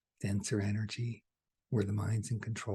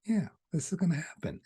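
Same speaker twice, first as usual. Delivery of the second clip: much higher, bright oh yeah; knowing realization; 9 semitones higher, 4 dB louder than usual. Retold or imitated. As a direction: sound like it just clicked. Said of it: "attend to this video knowing".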